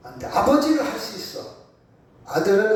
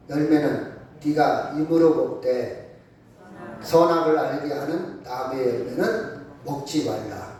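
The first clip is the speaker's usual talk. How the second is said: scripture reading; 5 semitones lower than usual. Acted. Preacher leads congregation reading Genesis, measured recitation. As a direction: read the verse out in measured phrases. Reads a Bible verse aloud.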